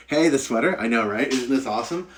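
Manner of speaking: usual speaking voice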